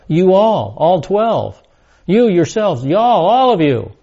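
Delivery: "you" and "all" punched high; emphatic plural you. Shown as a demonstration. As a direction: hammer each You and all, one phrase at a time. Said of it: "the Greek is very emphatic here".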